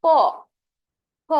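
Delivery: ক and খ said alone, lips still; teacher contrasting ক খ with lip sounds; consonant demo. Shown as a demonstration. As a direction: say ক then খ separately. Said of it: "যদি আমরা উচ্চারণ করি".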